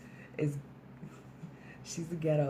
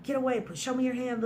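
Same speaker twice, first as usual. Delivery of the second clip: high, raised-voice imitation of a cop; urgent police order; 10 semitones higher, 3 dB louder than usual. Cop pulls the gun on her, urgent high-pitched order. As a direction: act out a panicked cop's sharp commands.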